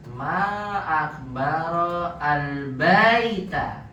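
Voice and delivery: drawn out very slowly. Shown as a demonstration. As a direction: stretch each word for the student. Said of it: "pelan-pelan". (sangat pelan dan jelas)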